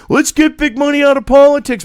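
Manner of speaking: high, loud slogan parody; mock Cenk slogan. Mocking Cenk's big money slogan, high and loud. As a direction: sneer out his catchphrase. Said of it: "You don't hear Cenk".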